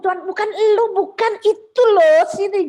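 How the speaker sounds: high, loud call. Retold or imitated. Retelling the call for Lili, high and loud. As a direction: wave him off and call her over. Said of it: "Aku ngomong".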